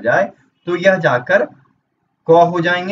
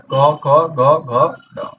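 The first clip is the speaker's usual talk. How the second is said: each sound of "क, ख, ग, घ" said slowly and apart; letters spelled out slowly; much slower than usual. Shown as a demonstration. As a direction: slowly count off क, ख, ग, घ like a teacher. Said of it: "इनके वर्ग हो गए आपके क वर्ग". (धीरे धीरे "क, ख, ग, घ" बोलना)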